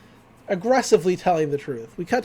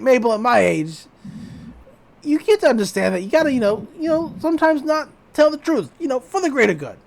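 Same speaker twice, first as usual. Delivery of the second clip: voicing Grunkle Stan; 5 semitones higher, 5 dB louder than usual. Stan voiced in retelling.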